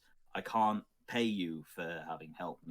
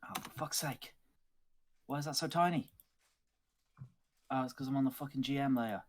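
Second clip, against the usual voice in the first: high-pitched frustrated cursing; real exasperation; 7 semitones higher, faster than usual. Real, not acted. Game master fighting the map tool, exasperated. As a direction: curse at the uncooperative screen, pitch climbing.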